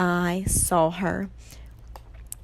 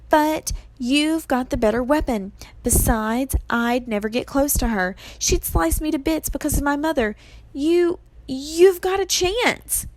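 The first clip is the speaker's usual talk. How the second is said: higher-pitched, reluctant urging; difficult admission; 7 semitones higher than usual. A narrator's reading. Annabeth admits Percy is better placed, reluctantly urgent. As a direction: admit it, rising to an earnest plea. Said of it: "about to make a difficult admission".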